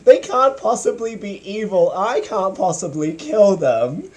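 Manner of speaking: high whining heroine voice; whiny Sailor Moon voice. Retold or imitated. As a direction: whimper like a distraught heroine. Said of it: "because she's like".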